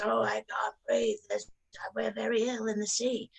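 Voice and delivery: a hint of a gasping fish; Mister Fish. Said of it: "Mister Fish comes out and goes".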